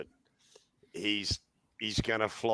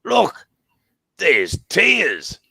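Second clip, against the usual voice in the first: very high, loud mocking call; excited yell; 10 semitones higher, 10 dB louder, much slower than usual. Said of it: "someone sprints around and goes".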